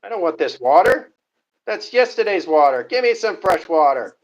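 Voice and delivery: high, loud voice of a fussy dog; put-on talking-dog voice, demanding. Speaking as the dog, demanding water.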